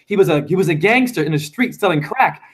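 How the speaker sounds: normal talking voice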